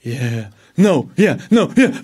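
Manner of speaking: wavering inner voice. Retold or imitated. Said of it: "Another voice says".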